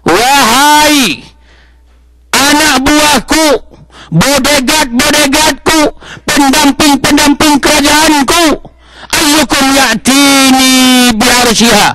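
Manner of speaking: slow, high solemn call to his court; prophet's proclamation. Acted. Prophet Sulaiman addresses his court, slow and solemn. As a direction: proclaim it slowly like a king to his men. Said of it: "Kata Nabi Sulaiman".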